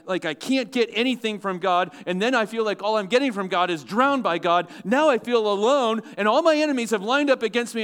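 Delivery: high, loud pulpit preaching; preaching at full force. Acted. Preacher stirring the congregation, high and loud. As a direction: build the congregation up to the question.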